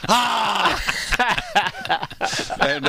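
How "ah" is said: sharp high cry of pain, louder; pained yelp. Retold or imitated. Bandmate yelping when his elbow gets grabbed, sharp pained cry. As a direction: yelp as your sore elbow gets yanked. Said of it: "he's like".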